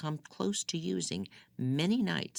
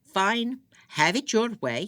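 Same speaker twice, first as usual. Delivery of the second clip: much higher, louder bitter snap; angry dismissal; 10 semitones higher, 8 dB louder than usual. Acted. Furious husband giving up at the locked door, loud bitter dismissal. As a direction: throw the words at the door. Ahmed enraged gives up.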